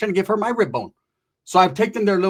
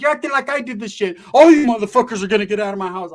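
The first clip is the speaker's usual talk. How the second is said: mock angry retort; 6 semitones higher, 7 dB louder than usual. Arguing back at uncle.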